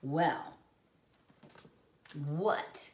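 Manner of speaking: ordinary delivery, nothing marked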